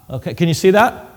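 usual speaking voice

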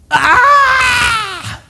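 high shouted cry of agony; scream of pain. Acted. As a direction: yell out as the dislocated shoulders give. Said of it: "This is what they say".

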